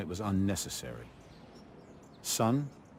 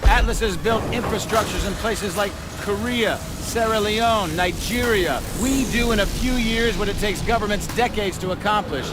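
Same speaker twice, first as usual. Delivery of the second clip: loud, raised presentation voice addressing a crowd; commanding keynote speech, louder and higher; formal sales pitch; 12 semitones higher, 7 dB louder than usual. Acted. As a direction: project it like a CEO showing off his army. CEO presents Atlas to recruit.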